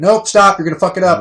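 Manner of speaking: loud, high shout of alarm; panicked retold shout; yelling stop. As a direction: yell at them to stop pushing. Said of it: "I was like".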